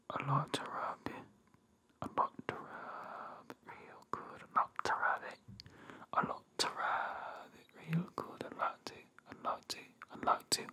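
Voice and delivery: very soft, teasing whisper; seductive whisper. Pretend. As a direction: whisper it like a mock-sensual chant. Mock seductive massage talk, repeated phrases.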